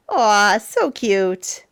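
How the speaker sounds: plain reading voice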